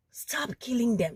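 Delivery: ordinary delivery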